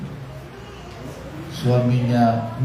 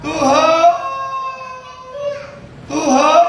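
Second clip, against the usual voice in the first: wife's tearful prayer; 12 semitones higher, slower than usual. Acted. Wife begging God for her husband, sung tearful wail. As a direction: wail it out like a desperate wife praying. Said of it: "ibu ini menangis untuk suaminya".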